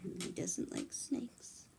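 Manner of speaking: slow whispered aside, "doesn't" lifted; hushed teasing whisper to the little listener; secret whisper. Pretend. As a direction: whisper it like a shared secret to a child.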